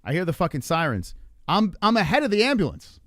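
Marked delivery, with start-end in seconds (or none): 1.5-3.0 loud, high exclamation of disbelief; incredulous outburst. Retold or imitated. Driver hearing sirens behind him, loud incredulity. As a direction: exclaim it as an absurd realisation. Realising he has outrun the ambulance.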